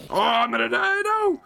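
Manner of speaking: high, loud terrified cry; panicked scream. Real, not acted. Player screaming as the creeper is about to blow. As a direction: scream like death is a second away.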